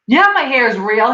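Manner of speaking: a touch of mock irritation, pitch up; imitating annoyed friend. Friend answering people who doubt his hair, mildly annoyed. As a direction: snap it back like he's tired of the question. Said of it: "he gets so pissed".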